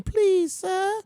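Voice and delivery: high, meek mock plea; pleading mock beggar. Germany begging Russia for fuel, meek and high. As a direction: squeak it out like a timid beggar. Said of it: "they didn't have an English accent".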